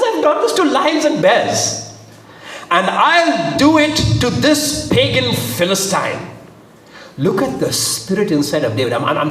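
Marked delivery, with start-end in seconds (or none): 0.0-6.3 high, emphatic vow; aggressive conviction. A narrator's reading. David vowing to Saul he'll fight Goliath, aggressive. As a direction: drive it home, slowing on the threat. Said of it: "It's a very aggressive spiritual spirit".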